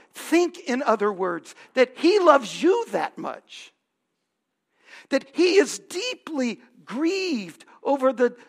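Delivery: loud, impassioned sermon delivery; preaching at full force. Acted. Pastor urging the congregation about God's grief, impassioned preaching. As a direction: drive each line home from the pulpit.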